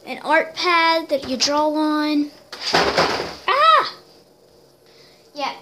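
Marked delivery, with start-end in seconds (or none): 2.7-3.9 high, loud yelp; sudden yelp. Real, not acted. Vlogger interrupts her gift rundown, sudden high yelp. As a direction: cry out sharply, high-pitched. Sudden outburst while showing presents.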